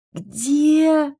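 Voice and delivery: high, loud, incredulous; astonished, rising query; startled question. Acted. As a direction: shoot up in disbelief. Startled by what she hears.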